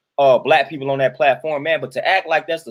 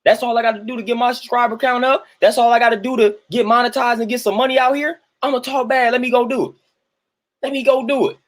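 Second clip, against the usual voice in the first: higher, rushing-to-cash-in youngster voice; eager young clout-chasers; 6 semitones higher than usual. Acted. Imitating youths after notoriety and subscribers, higher-pitched eager scheming. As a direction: pitch it up like kids chasing subscribers. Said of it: "So they like".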